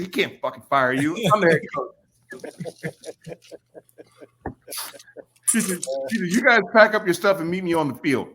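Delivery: lightly voiced head coach taking charge; imitating head coach. Head coach overruling assistant.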